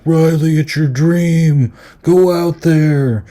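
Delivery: high, loud and slow, like a beaming dad; hammed-up proud dad pushing his kid on stage; proud father. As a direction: cheer her on like a proud father who is faking it. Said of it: "being kind of a proud father".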